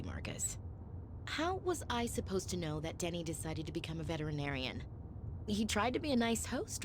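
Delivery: usual speaking voice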